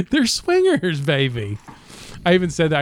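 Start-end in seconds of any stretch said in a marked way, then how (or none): 0.1-1.6 Austin Powers. Retold or imitated. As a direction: purr baby like a swinging sixties spy. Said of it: "Austin Powered them".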